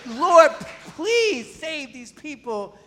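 high, loud cry of Lord then slow plea; pleading prayer. Retold or imitated. Preacher imploring God for the people, high urgent plea. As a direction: implore the Lord on their behalf. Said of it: "It means".

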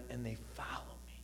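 whispered scripture ending. Acted. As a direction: let the verse fall to a whisper. Quoting Jesus, voice fades.

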